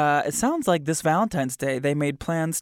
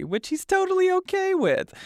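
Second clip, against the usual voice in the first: much higher, sing-song sarcastic; mock cheery. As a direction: pretend it's perfectly fine. Host mocking Gary's answer.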